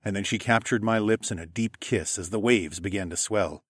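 plain reading voice

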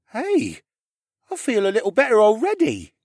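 put-on high, loud boyish voice; young lad perking up; revived boy. A narrator's reading. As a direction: pipe up high, pleasantly amazed to feel better.

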